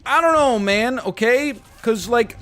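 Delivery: high, loud defensive burst; exasperated outburst. Acted. Streamer defending himself for not brushing earlier, exasperated. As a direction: burst out loudly in mock exasperation. Answers own question in exasperation.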